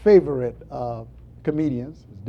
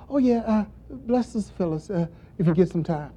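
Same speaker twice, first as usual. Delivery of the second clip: casual prayer; 7 semitones higher than usual. Acted. Preacher mocking a careless prayer for Sister Phyllis, casual and offhand. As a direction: toss off a half-hearted prayer. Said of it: "It shouldn't be casual".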